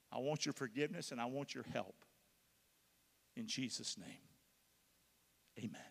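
earnest pleading prayer, fading to a hush; example prayer. Shown as a demonstration. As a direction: model a humble confession for them. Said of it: "Something like this".

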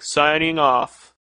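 slow, stretched sign-off; drawn out. Pretend. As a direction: stretch it out slowly. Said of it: "all say something in unison".

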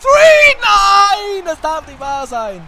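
high, loud shouted pin count; wrestling commentator. Real, not acted. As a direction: shout the count, then disbelief. Pin count, shock finish.

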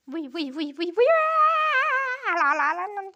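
rising, loud silly vuy-vuy noises; playful noise-making. Pretend. Child playing while dipping the brush, silly loud noises. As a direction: burst from vuy-vuy into loud la-la babble.

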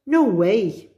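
put-on a storybook boy's defiant yell; yelled refusal. A narrator's reading. Danny refusing to go to his aunt's, yelled. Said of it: "he yelled".

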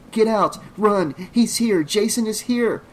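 high, loud urgent warning; panicked cries to run for it; screaming. A narrator's reading. Trish's mind warns her that Jason is here, urgent high scream. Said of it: "her mind screamed at her".

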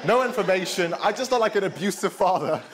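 usual speaking voice

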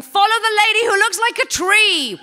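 high, loud call across a playground; teacher shouting. Retold or imitated. School teacher calls the children to follow her, shouting high and loud. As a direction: yell for the kids to follow.

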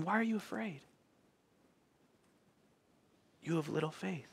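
tender quiet question from Jesus; gentle not angry. Acted. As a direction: ask it softly, without anger. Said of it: "That's not anger".

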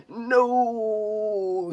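very high, loud, long scream. A narrator's reading. Stabbed villain crying out in horror, screamed. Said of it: "he screamed".

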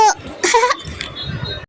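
quiet breathy giggle; a little unvoiced chuckle at the hippo; soft laugh. Real, not acted. (చిన్నగా నవ్వు)

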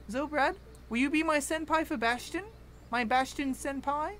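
high, cutesy pleading voice; cutesy high plea. Pretend. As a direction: plead sweetly like a fan.

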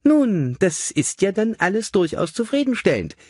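high, chirpy lady's voice; brisk cheerful lady. A narrator's reading. Mother showing her son the figures, brightly high. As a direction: hand it over brightly, lilting high.

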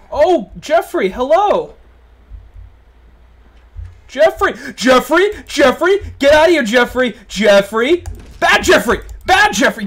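high, loud frantic shouts; panicked yelling. Real, not acted. Gamer shouting at the monster Jeffrey, panicked yells. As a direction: scream at the monster to go away.

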